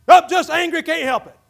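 a loud, mock-defiant excuse. Preacher mocking an angry man's excuse, loud and defiant. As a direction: blurt it out like a stubborn man excusing his temper. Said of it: "I like that voice of that I'm a victim".

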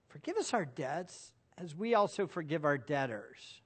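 hushed second reading; quiet, reflective repeat. Shown as a demonstration. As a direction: let the words sink in quietly. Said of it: "Notice the little phrase".

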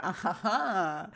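slow, soft put-on "ah ha ha"; mock fake laugh. Two friends share a look, slow mock laugh. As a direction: draw out a knowing, sappy fake chuckle. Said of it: "and they share a".

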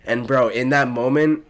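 ordinary delivery

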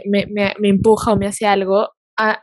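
ordinary talk

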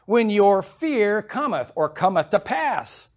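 high, loud preaching of scripture; fiery preaching. Acted. Preacher warning the congregation, full-force preaching. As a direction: thunder the warning from Proverbs.

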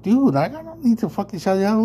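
high, fast indignant comeback; retold angry retort. Telling the dude off in the restroom, high fast indignation. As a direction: snap back, pitched high and quick. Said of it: "I was like".

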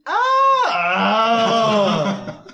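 loud high yelp with a laugh; mock yelp. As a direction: yelp again as he sits. Sits on the device. (grito agudo fingido)

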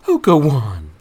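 high, loud coy protest; giggly coy woman. A narrator's reading. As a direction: brush off the teasing, high and playful. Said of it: "she giggled back".

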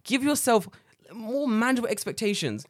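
high, loud exasperated telling-off; mock rant. Lecturing the teacher in his head, loud high scolding. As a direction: scold them like naughty children.

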